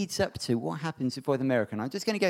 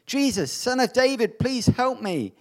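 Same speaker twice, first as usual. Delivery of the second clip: shouting plea; 8 semitones higher, 6 dB louder than usual. A narrator's reading. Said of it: "so he began shouting".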